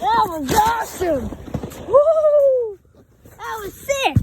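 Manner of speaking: loud, high thrilled cheering; excited. Real, not acted. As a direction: shout with joy after the ride. Just finished the sled run.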